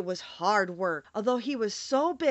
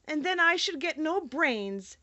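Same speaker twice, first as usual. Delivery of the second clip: lightly raised Scarecrow; 7 semitones higher than usual. Acted. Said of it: "said the Scarecrow".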